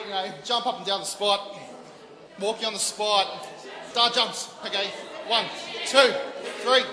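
a light take on a barking PE drill sergeant. Pretend. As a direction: bark the warm-up orders like a gym drill sergeant, only half committed. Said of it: "I'm getting my drill sergeant voice on".

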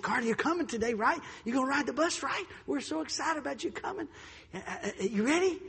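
chirpy pretend excitement to a child; feigned cheerful innocence. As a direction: act as if nothing were wrong. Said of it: "I act like I didn't hear anything".

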